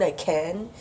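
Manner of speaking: usual speaking voice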